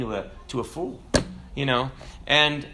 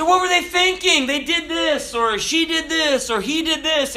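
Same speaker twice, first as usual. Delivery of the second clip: very high-pitched mock disbelief; hammed-up, high-voiced indignation; scandalized outcry; 13 semitones higher, 4 dB louder than usual. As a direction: squeal it like an appalled onlooker tattling. Acted gossip reacting to someone's folly.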